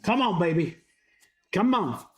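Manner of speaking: ordinary talk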